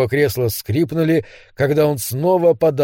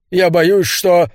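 louder, higher burst of fear, cut short; anxious outburst left hanging; fearful confession, breaking off; 3 semitones higher, 4 dB louder, slower than usual. Acted. Frightened man breaks off mid-confession.

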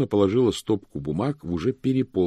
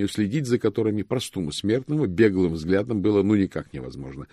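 ordinary delivery, nothing marked

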